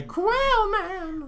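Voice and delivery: high, grand superhero shout; hero announcer call. Pretend. Co-host announcing Quail Man, in a high heroic cry. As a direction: call out the hero's name like a cartoon intro.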